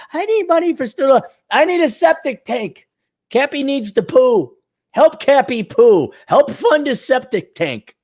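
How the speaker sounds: put-on high-pitched parody of a begging girl; mock pleading girl. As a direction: beg in a silly high voice. Mimics girls begging for money.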